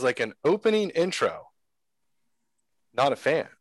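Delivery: ordinary talk, nothing marked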